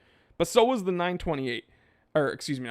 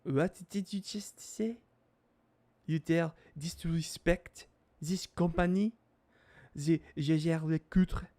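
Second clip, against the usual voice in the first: put-on slow, offended French accent; indignant Frenchman, drawn-out words and French vowels; French snob; slower than usual. French snob scolding someone over a watch brand, snooty French accent. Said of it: "French snobs will be like".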